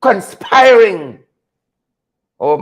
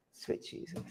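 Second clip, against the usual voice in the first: whispered under the breath; a whispered Sweet Jesus; whispered prayer; 28 dB quieter than usual. Real, not acted. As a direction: breathe it in dismay. Muttered exasperated prayer.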